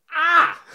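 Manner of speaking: high loud yelp of embarrassment; shrieking at the memory; mock scream of shame. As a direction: let out a cringing scream. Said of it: "just, like".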